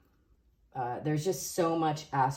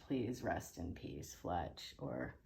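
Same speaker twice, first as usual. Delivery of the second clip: reverent tribute; 8 semitones lower, 13 dB quieter, slower than usual. Real, not acted. Tribute to dead musician.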